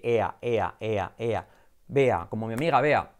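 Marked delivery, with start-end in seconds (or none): 0.0-1.5 low, repeated "ea" glides; "ea" as two vowels run together; diphthong demo. Shown as a demonstration. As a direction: glide e into a within one syllable in "ea". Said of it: "dos vocales en una misma sílaba. Fíjate".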